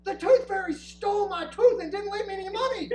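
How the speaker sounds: put-on high, loud outraged child yell; outraged kid shout. Daughter bursting in to complain, high loud yell. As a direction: shout it like a robbed little girl. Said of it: "she goes".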